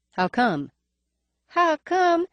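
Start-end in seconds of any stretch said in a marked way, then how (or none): 1.5-2.3 high nasal echo, faint lisp. A narrator's reading. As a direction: throw it back, higher and nasal. Emerald echoes the question.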